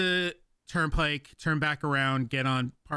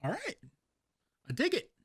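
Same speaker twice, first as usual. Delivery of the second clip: surprised delight; 9 semitones higher, 4 dB quieter than usual. Retold or imitated. Pleased by twenty tip.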